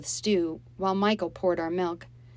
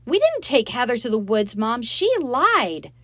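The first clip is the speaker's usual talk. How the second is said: put-on higher and louder, girl insisting on the truth; desperate protest; 7 semitones higher, 9 dB louder than usual. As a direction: beg her to believe you. Molly pleading with Mom.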